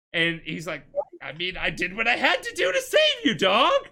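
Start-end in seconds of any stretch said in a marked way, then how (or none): 1.2-3.9 high, loud mock indignation; acted defensive protest. Nephew justifying himself to his uncle, loud mock protest. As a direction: shout your excuse, playfully hammed-up.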